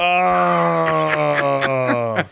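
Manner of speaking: drawn-out "oh" of dismay; long dismayed groan. Real, not acted. Host hears they just missed Hogan, long dismayed groan.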